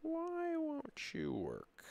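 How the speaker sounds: high, slow, plaintive plea; pleading with a machine. Real, not acted. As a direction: beg the program to work. Begging the software, drawn out.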